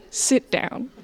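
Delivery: hushed, condescending near-whisper; pitying Europeans. Retold or imitated. Europeans to a boastful young American, quietly patronizing. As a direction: let them down softly, with a pause before the dismissal. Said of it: "And Europeans are like".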